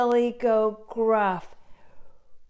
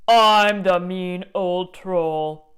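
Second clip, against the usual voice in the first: mean troll; 7 dB louder than usual. Acted. Troll warning everyone off his bridge, loud. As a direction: announce yourself as the bridge's boss.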